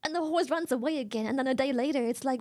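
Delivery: ordinary delivery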